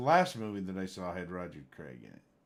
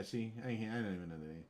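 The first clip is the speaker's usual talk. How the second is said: ordinary talk, nothing marked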